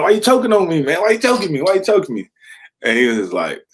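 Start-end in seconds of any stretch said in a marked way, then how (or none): 0.0-2.3 high-pitched, frantic mock protest; pitched way up, indignant and panicked; retold protest. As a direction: re-enact squawking while being choked. Retells protesting a choke hold.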